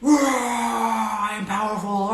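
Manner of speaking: drawn-out high roaring shout; dragon roar. Shown as a demonstration. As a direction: roar it out slowly, full force. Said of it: "like a dragon roar".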